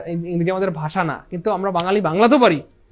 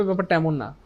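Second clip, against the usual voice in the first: normal talking voice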